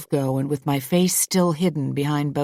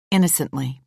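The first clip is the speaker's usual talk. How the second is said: plain reading voice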